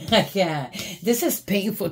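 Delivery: breaking off into a real laugh; amused, too tickled to finish; laughing at someone. Real, not acted. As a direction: laugh cuts into the words. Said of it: "I'm laughing at them".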